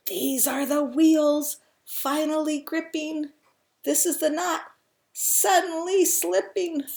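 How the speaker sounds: high, loud, excited narration; suspenseful storytelling. A narrator's reading. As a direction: drive the tale toward its climax. Story builds to climax.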